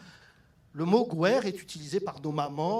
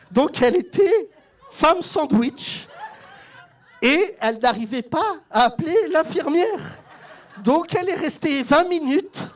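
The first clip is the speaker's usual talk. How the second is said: words pushed out through laughter, high and loud; cracking up mid-story, much higher and louder; laughing while telling; 7 semitones higher, 9 dB louder than usual. Real, not acted. Comedian to audience, laughing through the hospital story.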